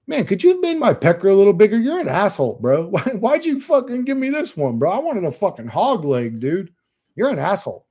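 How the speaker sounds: playful outraged complaint; mock outrage. Real, not acted. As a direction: gripe at his maker, half laughing. Joking complaint to his maker.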